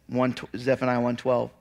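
normal talking voice

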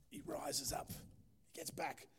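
whispered, slow build of suspense; hushed narration. Real, not acted. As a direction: breathe it out like a secret. Dramatic climax of retold scene.

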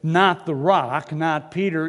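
ordinary talk

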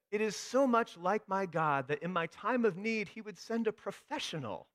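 high, loud praise of God; exultant woman. Retold or imitated. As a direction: proclaim it with pitch held well up.

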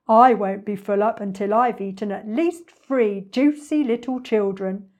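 loud, gloating villain soliloquy; greedy storybook villain; hungry crocodile. Acted. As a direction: relish the coming feast aloud.

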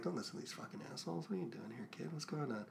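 hushed, fast secretive whisper; conspiratorial whisper. Retold or imitated. Jar Jar secretly coaxing Anakin, fast hushed whisper. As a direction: lean in and whisper it like a schemer.

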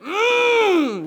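high, straining "mmm" groan; fervent groan. Retold or imitated. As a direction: groan as if it has to burst out. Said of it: "groanings and stuff want to come out of me".

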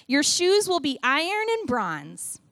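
usual speaking voice

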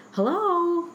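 high, loud comic greeting; funny phone voice. Retold or imitated. Web team caller answering her, high funny voice. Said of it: "like, a funny voice".

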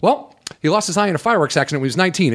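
fast, tossed-off aside; rushed offhand. Retold or imitated. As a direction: blurt it out casually. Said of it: "she goes".

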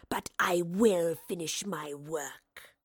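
slow, snarling monster voice; wraith's snarl. A narrator's reading. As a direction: snarl it slowly as a menacing wraith. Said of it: "the wraith snarled".

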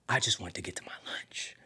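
whispered, desperate craving; soft, breath-only yearning; hushed longing. Retold or imitated. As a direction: whisper it as if aching for food. Longing for his lunch.

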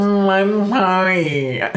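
very slow nasal whine; drawn-out whiny nasal echo; nasal Kai voice. Retold or imitated. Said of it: "that's my nasally impression of you".

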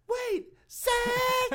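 high, loud mock call of the name; high mock mom call. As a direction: shout it like mom from the other room. Mom calling him back.

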